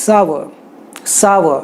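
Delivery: sour said sau-uh, rhyming with hour; correct sour. Shown as a demonstration. Teacher showing students sour, rhyming with hour. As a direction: sour like hour, two beats. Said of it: "just like hour".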